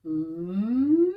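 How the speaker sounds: rising hum. Shown as a demonstration. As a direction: slide the hum up from the bottom. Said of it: "from the bottom up".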